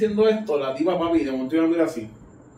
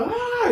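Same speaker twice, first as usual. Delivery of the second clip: high mock shriek; retold scream, hammed up; imitated scream. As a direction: shriek like she's terrified.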